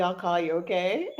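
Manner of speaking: ordinary talk, nothing marked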